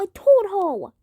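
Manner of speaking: normal talking voice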